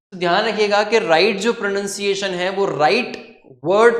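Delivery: ordinary talk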